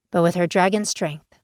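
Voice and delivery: usual speaking voice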